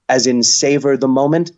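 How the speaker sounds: ordinary talk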